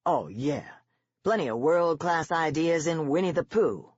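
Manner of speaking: dry mocking sarcasm; scornful soldier mocking the teacher's story; sarcastic. A narrator's reading. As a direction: say the opposite of what you mean. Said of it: "Sergeant is using sarcasm".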